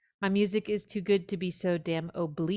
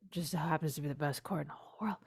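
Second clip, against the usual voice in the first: a hushed, fast whisper of delight; whispered aside; 3 semitones lower, 7 dB quieter, faster than usual. Real, not acted. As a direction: lean in and whisper it like a secret. Gushing aside about the chord.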